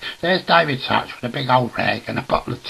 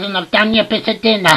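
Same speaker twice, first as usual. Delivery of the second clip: quick, high, loud housewife call; acted high domestic shout; shouting upstairs; 8 semitones higher, 6 dB louder, faster than usual. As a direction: rattle it up the stairs at volume. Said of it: "She shouts up the stairs".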